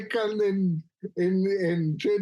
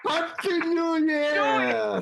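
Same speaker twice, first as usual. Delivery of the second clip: laughing; 8 semitones higher, much slower than usual. Acted. Laughing joke exclamation.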